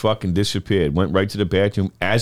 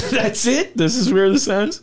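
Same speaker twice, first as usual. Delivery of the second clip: high incredulous question; can't believe it; disbelief; 11 semitones higher, slower than usual. Retold or imitated. Disbelief at diagnosis.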